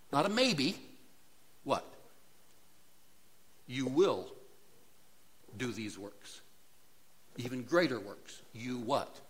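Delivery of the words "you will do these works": will hit and held, then falling; emphatic promise with will stressed. Shown as a demonstration. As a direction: land hard on will. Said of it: "It's emphatic".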